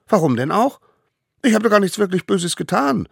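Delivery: high, loud indignant protest; stubborn child. A narrator's reading. Smallest savage refuses to apologise, indignant. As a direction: protest innocence in a squeaky child voice.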